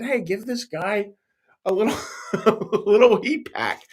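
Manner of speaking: high voice breaking into a laugh; laughing quote. Retold or imitated. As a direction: chuckle midway through the suggestion.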